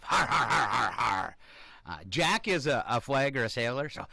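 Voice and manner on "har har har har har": hearty mock pirate laugh har har har. Shown as a demonstration. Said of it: "a pirate laugh".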